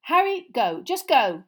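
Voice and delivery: loud, urgent shouted order. A narrator's reading. Mister Weasley, wand on Uncle Vernon, shouting at Harry. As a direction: shout him out of the room. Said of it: "Mister Weasley shouted".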